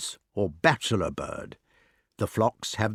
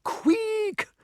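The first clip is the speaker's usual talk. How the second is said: very high tiny squeak; shrew squeal. A narrator's reading. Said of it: "squeals out".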